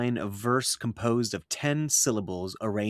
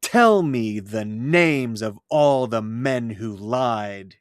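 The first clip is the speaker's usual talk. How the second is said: "Tell" hit hard, louder and slow, line read beat by beat; inverted first iamb; 6 dB louder, slower than usual. Shown as a demonstration. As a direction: open with a punched "Tell", then march the meter. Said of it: "Some poets will invert the first iamb".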